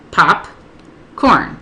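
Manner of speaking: "pop, corn" as two separate words, a clear pause between; broken by syllable. Shown as a demonstration. Teacher modeling breaking up a word, syllable by syllable. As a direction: split "pop" from "corn" with a beat of silence. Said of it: "Break it up by syllable".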